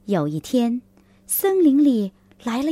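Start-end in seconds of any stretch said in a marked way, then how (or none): none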